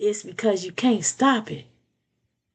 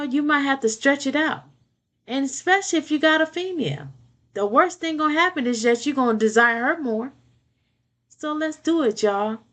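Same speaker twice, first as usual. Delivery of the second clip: ordinary delivery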